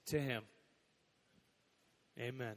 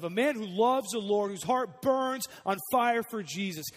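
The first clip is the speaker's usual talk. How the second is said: fiery preaching; 4 semitones higher, 6 dB louder than usual. Acted. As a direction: proclaim it with fire. Preaching climax.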